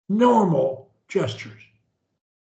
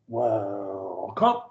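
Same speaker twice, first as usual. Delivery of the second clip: start low; 10 semitones lower, 5 dB quieter than usual. Shown as a demonstration. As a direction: WELCOME very low and soft at first, jumping up on COME. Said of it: "or low".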